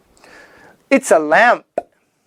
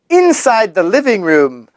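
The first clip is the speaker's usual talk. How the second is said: ordinary delivery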